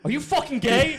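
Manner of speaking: high, loud Jersey dad demanding; New Jersey accent. Retold or imitated. Dad grilling son in New Jersey accent. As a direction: bark the question like a Jersey dad. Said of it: "I also gave him a New Jersey accent".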